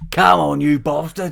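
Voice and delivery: put-on hard-man taunt in an English accent; Tom Hardy. Friend showing off his impression, hammed-up aggression. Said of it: "he talks a wee bit like that".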